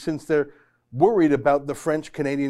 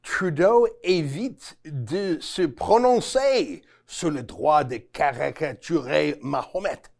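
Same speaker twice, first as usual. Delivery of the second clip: slow, laboured French in an amateur accent; slower than usual. Shown as a demonstration. Host showing viewers the original French headline, terrible French accent. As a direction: read the French slowly in your self-confessed terrible French accent. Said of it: "What do you think of my French accent?".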